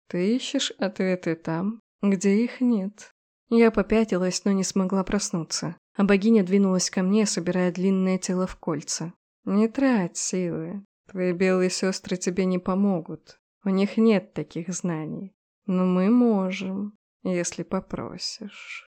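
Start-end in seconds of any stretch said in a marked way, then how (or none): 0.1-3.1 slow hypnotic serpent hiss. Acted. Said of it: "Её голос напоминал шорох сухих листьев по льду". 9.4-18.9 slow hypnotic serpent hiss. Acted. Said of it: "Её голос напоминал шорох сухих листьев по льду".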